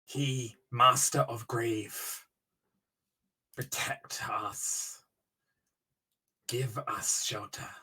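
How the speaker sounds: slow, low, hushed incantation; goblin inscription read out. Acted. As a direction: read it like a grave prayer, drawn out and soft.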